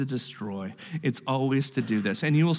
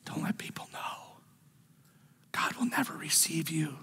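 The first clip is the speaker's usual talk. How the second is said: the enemy's voice hissed quietly in your head; whispering; 5 dB quieter than usual. Acted. Preacher as the enemy telling the listener they're a scam, whispering. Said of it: "Or is it whispering".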